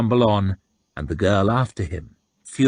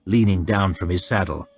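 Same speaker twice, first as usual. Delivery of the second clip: plain reading voice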